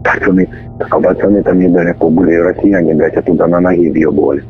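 over a radio. Real, not acted. Intercepted radio chatter, distorted.

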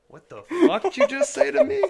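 loud high shriek with a laugh; angry shout. Pretend. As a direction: shriek it, outraged. Acted girlfriend's furious reaction.

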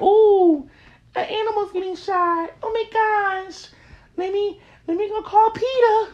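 mock the people who panic over animals but not people; mocking fretful animal lover. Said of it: "you willing to be like".

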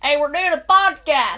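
loud, hyped host shout; excited podcast intro. Pretend. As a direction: hype up the podcast opening.

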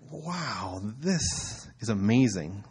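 soft, slow sarcastic wonder; understated ironic wow; mock awe. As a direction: draw it out, deadpan amazed.

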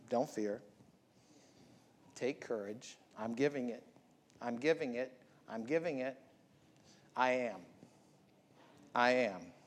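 low, soft divine voice; voice from the burning bush. Acted. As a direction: speak it hushed and low like God from the bush. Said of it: "Same voice that came out of the burning bush".

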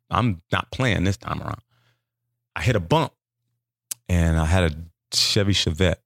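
ordinary delivery

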